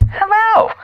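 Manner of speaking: very high, put-on mother voice; mom's affected voice. Host mimics his mother answering the phone, affected high voice. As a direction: answer in an exaggerated high mom voice. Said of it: "sounded like Linda from Bob's Burger".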